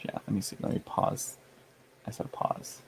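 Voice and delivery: muttered under breath; whispered. Real, not acted. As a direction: mutter to yourself. Muttering to self.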